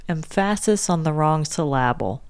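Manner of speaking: "syllable" said as suh-LAB-ul; wrong-stress "syllable". Shown as a demonstration. Co-host showing the host the joke, "syllable" as suh-LAB-ul. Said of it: "Please say the word".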